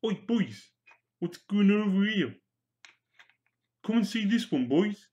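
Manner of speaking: put-on high-pitched, rough Welsh accent; attempted Welsh accent. Welsh orc calling the boys over, dodgy Welsh accent. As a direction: call out in a hammed-up Welsh accent. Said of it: "That was supposed to be Welsh".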